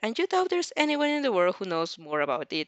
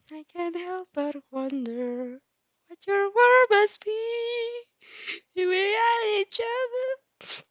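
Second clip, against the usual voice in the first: high, slow, dreamy inner voice; wistful high musing; 12 semitones higher, slower than usual. Acted. The girl wondering about her shadow, high and slow.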